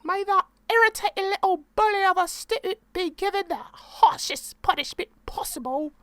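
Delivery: very high, mock-pious posh English prayer; posh lady praying. Praying to God about the student, very high hammed-up posh English accent. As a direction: pray for the bully's punishment, high and prim.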